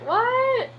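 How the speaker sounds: very high puzzled "What?"; confused kids. Retold or imitated. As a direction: mimic baffled students. Kids' confused reaction.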